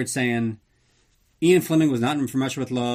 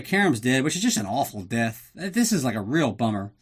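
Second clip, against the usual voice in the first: ordinary talk, nothing marked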